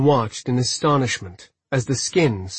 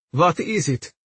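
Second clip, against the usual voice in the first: higher, timid question; trembling voice; 4 semitones higher than usual. A narrator's reading. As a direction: ask it with a nervous tremble. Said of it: "voice trembling".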